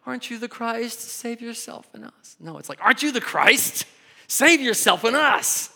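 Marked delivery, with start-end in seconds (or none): 0.0-2.3 higher-pitched, soft plea; mild questioning read. Shown as a demonstration. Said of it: "you can read that like". 2.8-5.7 high, loud imperative yell; demanding shout. Shown as a demonstration. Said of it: "he's not asking. He's telling".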